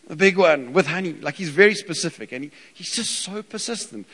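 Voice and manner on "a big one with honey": put-on high, loud, more insistent kid voice; little boy insisting on tea; toddler asking again. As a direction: push the demand harder like a stubborn toddler. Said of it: "And again, it's".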